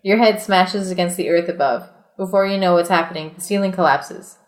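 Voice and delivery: steady read-aloud storyteller voice; reading the adventure book aloud to friends, measured narration; story narration. A narrator's reading. Reads gamebook narration aloud.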